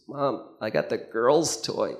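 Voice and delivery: lightly voiced upset four-year-old, slow; a hint of a crushed little kid; sad little boy. Boy tells mom in the car about his toy, lightly voiced and sad. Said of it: "And he goes".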